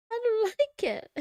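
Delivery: laughing protest. Real, not acted. Laughing complaint about ASMR.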